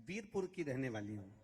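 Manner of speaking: ordinary delivery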